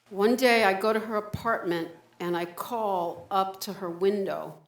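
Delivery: ordinary talk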